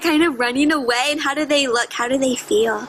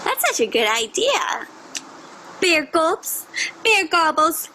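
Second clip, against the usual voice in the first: usual speaking voice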